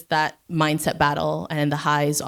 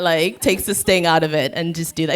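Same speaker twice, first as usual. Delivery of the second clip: usual speaking voice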